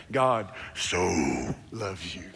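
very deep, slow crooner voice; low, lingering love-man impression. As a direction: drop way down and draw the words out slowly.

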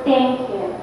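soft, gentle thank you; tender thanks. Shown as a demonstration. Speaker showing a grateful intention put into water, tender. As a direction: say it softly, with gratitude. Contrasting kind intention with insult.

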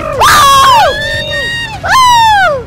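high, loud joyriding whoop; excited whoops. Pretend. Dad racing off with kitty, excited whooping. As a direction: whoop with abandon.

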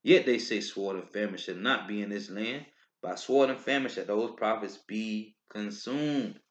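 low, measured Bible reading; scripture reading. Acted. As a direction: read the verses solemnly and deliberately. Reads scripture aloud.

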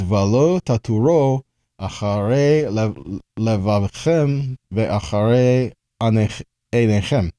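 slow Hebrew, Valo taturo achare levavchem read carefully. Shown as a demonstration. Teacher reading the Hebrew verse for the class, slow. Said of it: "in the Hebrew".

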